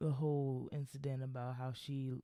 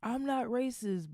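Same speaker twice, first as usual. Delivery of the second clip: mock denial; 10 semitones higher than usual. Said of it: "just so they can".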